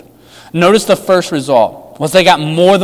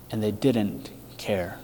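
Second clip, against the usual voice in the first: hushed grieving; 7 semitones lower, 14 dB quieter, slower than usual. Real, not acted. Sombre repetition.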